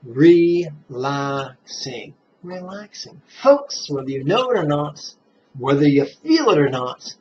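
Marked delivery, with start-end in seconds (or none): 0.1-2.1 Relaxing stretched very slowly; long slow exhale on Relaxing; slow drawn-out recitation. Shown as a demonstration. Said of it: "what do you mentally recite". 2.4-3.2 Relaxing softer, with a higher rise; gentle soft echo of Relaxing; soft repeat. Shown as a demonstration. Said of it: "what do you mentally recite".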